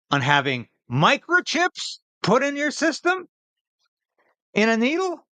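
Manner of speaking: mocking. Real, not acted. Host mocks Trudeau's claim.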